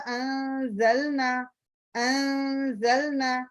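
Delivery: انزلنا with a light, hidden nasal n; light ikhfa demo. Shown as a demonstration. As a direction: stretch انزلنا slowly, tongue not touching for the n. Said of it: "اخفا بھی light ہوگا".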